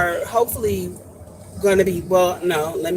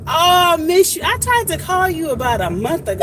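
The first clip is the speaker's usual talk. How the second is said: excited greeting; 8 semitones higher, 3 dB louder than usual. Real, not acted. Greeting friend she misses.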